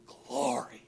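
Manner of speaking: soft, low, worshipful word; hushed temple response; hushed reverence. Acted. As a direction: breathe it out quietly in worship.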